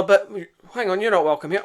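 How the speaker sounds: light take on a monk turning them away; lightly voiced prior refusing the soldiers; a hint of a wary prior. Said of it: "prior answering the soldiers".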